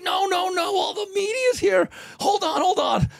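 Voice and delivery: shouting at the leaving champ to hold on; frantic pleading. Retold or imitated.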